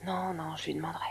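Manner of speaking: very soft, near-whispered promise; hushed and soothing; quiet reassurance. Acted. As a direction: reassure them gently, voice dropped low. Reassuring patient after a worried question.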